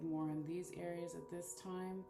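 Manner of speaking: ordinary talk, nothing marked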